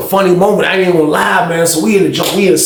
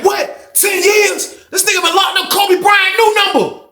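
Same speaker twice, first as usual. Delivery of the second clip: shocked outburst; slower than usual. Retold or imitated.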